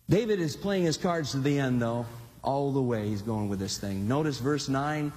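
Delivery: normal talking voice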